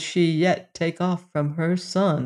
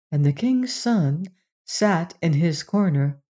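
ordinary delivery

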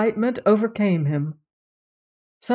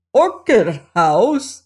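slow, louder, incredulous question; baffled echo; 3 semitones higher, 5 dB louder, much slower than usual. A narrator's reading. Saunderson puzzled by the doctor's demand, incredulous. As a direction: repeat it in disbelief, drawn out.

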